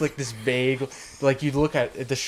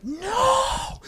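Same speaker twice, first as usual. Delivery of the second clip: very high, long squealed cry; mock scream of horror. Boy witnessing his mother's death, high mock scream. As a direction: wail the word, stretched out.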